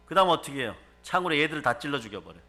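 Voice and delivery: ordinary delivery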